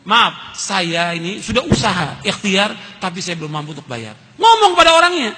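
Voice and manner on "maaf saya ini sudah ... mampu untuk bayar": humble apology. Retold or imitated. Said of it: "kita bilang datang kepada orangnya".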